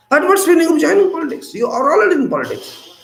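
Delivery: high, loud and fast; rapid rousing challenge; impassioned. Real, not acted. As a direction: fire the question at the crowd. Impassioned rhetorical speech.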